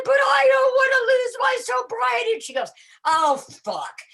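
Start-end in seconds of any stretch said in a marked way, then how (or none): 0.1-2.3 very high, loud mock whine; panicked wail. Patient objecting to morphine pump, hammed-up panicked wail. 3.1-4.0 loud, high mock disbelief; exasperated nurse. Nurse learning patient is sober, loud exasperation.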